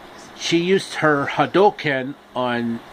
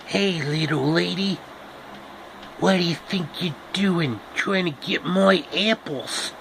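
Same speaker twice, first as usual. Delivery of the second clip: grumpy apple tree; 4 semitones higher, 4 dB quieter than usual. Acted. Apple tree warns Dorothy.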